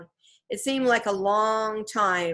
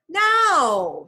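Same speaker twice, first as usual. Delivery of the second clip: loud, high drawn-out NOW; high, loud and insistent; insistent call; 8 semitones higher, 8 dB louder than usual. A narrator's reading. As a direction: cut in with an impatient, raised cry. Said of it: "said Annie".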